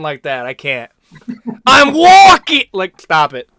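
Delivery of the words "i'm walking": high, loud mock Boston shout; hammed-up Boston accent cry; Boston accent yell. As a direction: belt it in an overdone Boston accent. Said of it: "the Boston accent".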